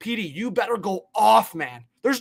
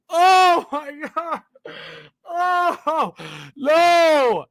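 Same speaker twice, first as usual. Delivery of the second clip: stunned groan; 7 semitones higher, much slower than usual. Real, not acted. Still reacting to the trade.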